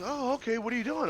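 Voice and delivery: a high, nosy follow-up question; curious neighbour. Retold or imitated. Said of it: "And she goes".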